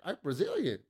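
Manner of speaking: very high, soft airy voice. Retold or imitated. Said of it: "his weird, eh, fucking [name] accent".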